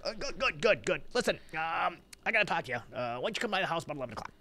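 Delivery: high, quick mayor voice; mayor impression. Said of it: "you still got his voice down".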